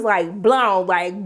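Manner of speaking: ordinary talk, nothing marked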